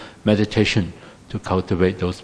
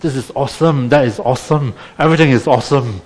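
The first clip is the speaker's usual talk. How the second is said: higher, fast hammed-up American gushing; mock American enthusiasm; 6 semitones higher, 3 dB louder than usual. A meditation teacher mocks American cheer, gushing brightly. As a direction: play an over-the-top cheerful American. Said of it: "you can be more American".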